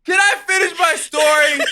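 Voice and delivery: high, loud plea through laughter; laughing protest. Real, not acted. Storyteller begging friends to let him finish, laughing. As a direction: shout over the laughing, cracking up.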